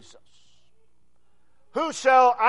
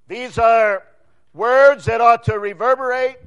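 ordinary delivery

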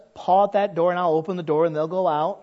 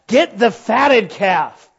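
slow emphatic command; 3 semitones higher, 7 dB louder, much slower than usual. Acted. Preacher insists.

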